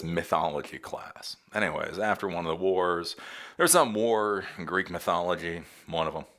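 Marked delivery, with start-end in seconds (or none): none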